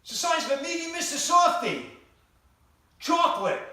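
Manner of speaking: loud, high deadpan holler; shouted punchline. Retold or imitated. Husband hollering the answer back, loud and high. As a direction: bellow the answer like it's obvious.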